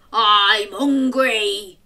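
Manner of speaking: slow, loud troll growl; troll roar. Acted. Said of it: "he roared".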